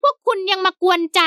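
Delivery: high servant voice, peeved; sulky high servant. A narrator's reading. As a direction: grumble it up high like the offended servant. Servant Haew sulks, glaring.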